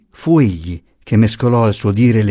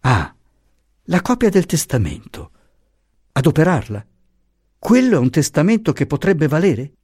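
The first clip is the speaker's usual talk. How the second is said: higher-pitched excited realization; pitched up, urgent discovery; sudden exclamation; 6 semitones higher than usual. A narrator's reading. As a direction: exclaim as the idea strikes you. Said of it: "esclamò".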